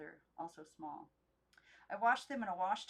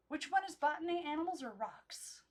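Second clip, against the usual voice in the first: high, bright-eyed puzzled question; the doll Timothy John puzzling; eager doll voice; 9 semitones higher, 6 dB louder than usual. Acted. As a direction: ask it like a curious toy.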